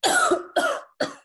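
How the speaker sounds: loud wet hacking cough; phlegmy cough. Acted. Old shopman coughing behind the curtain, loud phlegmy hack. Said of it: "Phlegmy coughs shook the air".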